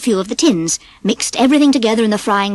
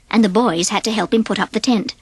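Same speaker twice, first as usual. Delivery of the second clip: ordinary delivery, nothing marked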